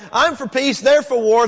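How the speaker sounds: the verse shouted high and brash; brash shouted retort; loud mock version. Shown as a demonstration. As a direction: fling it out like a dismissive exit line. Mock version of psalm ending.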